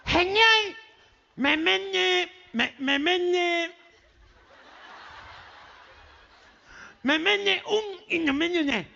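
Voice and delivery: high, garbled nasal order; gangoso, nasal twang. Acted. Boy orders from the butcher, high gangoso voice. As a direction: push unintelligible words through the nose, high. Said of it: "nene gangoso".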